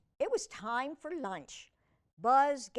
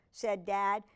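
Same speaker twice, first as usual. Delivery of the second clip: ordinary delivery, nothing marked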